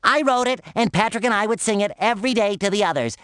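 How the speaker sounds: normal talking voice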